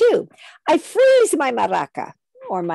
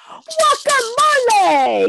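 belted out high and louder, not a shout; nice loud voice; 11 semitones higher, 4 dB louder, slower than usual. Shown as a demonstration. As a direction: sing it out big without shouting. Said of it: "with my loud voice, not shouting, but a nice loud voice".

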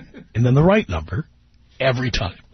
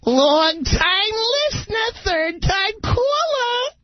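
much higher, slow, sing-song falsetto; mock falsetto echo; slower than usual. Radio host teasing a caller with his own catchphrase, mock falsetto. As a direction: tease him by singing his catchphrase back.

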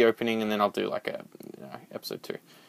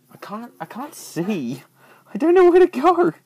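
rising into a high frightened wail; slow, then burst out lost and scared; panicked; 11 semitones higher, 8 dB louder than usual. Real, not acted. Player lost in darkness, rising panicked wail.

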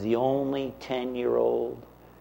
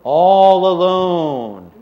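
drawn out; 7 semitones higher, 11 dB louder, much slower than usual. Acted. Lonely walk in the rain.

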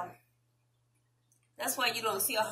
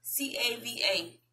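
usual speaking voice

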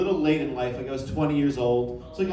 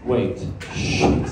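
mock slip; 9 semitones lower than usual. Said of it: "Did I just say that out loud?".

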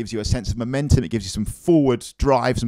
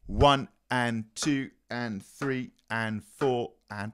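slow counted demo; 8 dB quieter, much slower than usual. Shown as a demonstration. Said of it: "On the French".

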